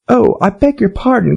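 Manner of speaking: put-on light, high girlish voice; young girl voice. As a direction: voice young Alice, dismayed. Alice's voice.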